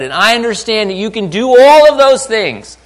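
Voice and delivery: high, loud mock concession; insistent Peter. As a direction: concede it emphatically before objecting. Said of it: "It's kinda like he says".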